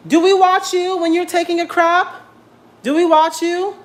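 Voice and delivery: mock outrage. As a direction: tell the cat off in playful disbelief. Scolding the cat for staring.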